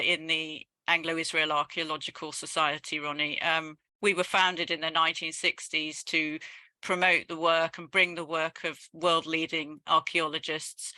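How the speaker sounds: usual speaking voice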